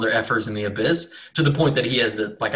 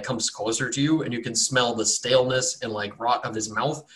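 ordinary talk, nothing marked

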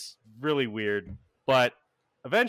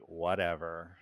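put-on lower, softer teenage brush-off; dismissive eye-roll; 6 semitones lower, 7 dB quieter than usual. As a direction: toss it away with an eye-roll. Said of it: "rolls her eyes and she's like".

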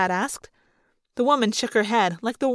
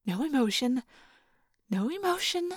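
hushed, tense self-reminder; telling oneself; 7 dB quieter than usual. A narrator's reading. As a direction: murmur it to steady yourself. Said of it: "I told myself".

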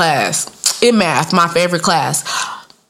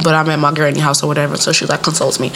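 ordinary talk, nothing marked

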